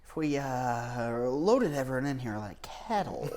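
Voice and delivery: slow, sinister scheming scientist; a hint of a mad genius plotting; evil scientist voice. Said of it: "Elon like an evil scientist".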